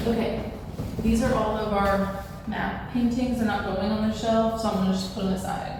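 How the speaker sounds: ordinary delivery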